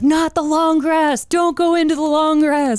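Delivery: very high, drawn-out mock yell; movie-quote holler, stretched and high; imitated panicked warning. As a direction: call out a hammed-up warning at the top of your range. Imitating a movie character's shouted warning.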